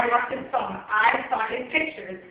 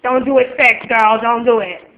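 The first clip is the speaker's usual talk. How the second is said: called warning; 9 dB louder than usual. Real, not acted. Calling a warning to the summoner.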